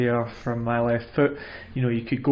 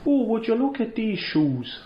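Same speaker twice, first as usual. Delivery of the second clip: put-on a slight Irish accent, pitched much higher; mock Irish lilt as Daniel Day-Lewis; 10 semitones higher than usual. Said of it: "I'd do a slight Irish sort of".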